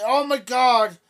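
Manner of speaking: loud, high mock panic; imitating a scared parent. Mimicking a fearful parent, mock panicked and loud. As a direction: ham up the parent's fright. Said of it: "you would say".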